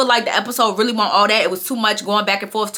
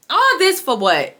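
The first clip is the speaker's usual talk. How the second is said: higher, slow, drawn-out complaint; exasperated; 5 semitones higher, slower than usual. Real, not acted. Vlogger venting to viewers about the episode, exasperated. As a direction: let the letdown sound in the voice.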